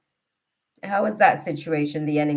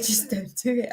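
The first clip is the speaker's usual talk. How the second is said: laughing repeat; 5 semitones higher than usual. Real, not acted. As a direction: toss it off laughing.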